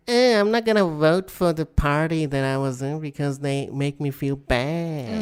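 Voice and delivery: whiny mock crybaby; childish sulk. Co-host mocks a sulking old dictator, childish whine. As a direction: sulk like a big baby, dragging out the end. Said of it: "now he's being all childish".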